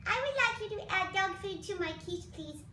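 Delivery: Kiwi the dog. Pretend. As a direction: give the dog a polite, pleading voice. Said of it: "What is that, Kiwi?".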